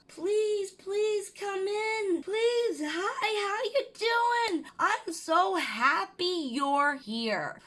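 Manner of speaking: overdone high saccharine greeting at the door; sweet hostess. Retold or imitated. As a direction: gush over the police like a delighted host. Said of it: "I welcomed them in my house".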